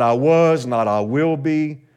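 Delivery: mock the wrong answers lightly; contrastive stress. Shown as a demonstration. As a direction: toss off the wrong tenses.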